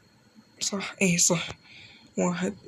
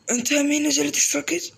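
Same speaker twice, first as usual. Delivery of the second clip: higher, louder question to the viewer; excited call-out; 6 semitones higher, 3 dB louder than usual. Real, not acted. Gamer hyping up a new subscriber, excitedly. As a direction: call out to a fan in the chat.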